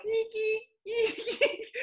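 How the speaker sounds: high squeaky doorbell noise, laughing; doorbell eek. Retold or imitated. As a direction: squeak out the bell sound high. Imitating the door bell ringing.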